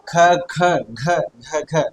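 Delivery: ख, ख, घ, घ, घ sounded out as separate syllables from the throat; demonstrating throat consonants. Shown as a demonstration. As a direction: say ख and घ slowly, each on its own like a teacher. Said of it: "तो इन्हें कंठ्य वर्ण कहते हैं".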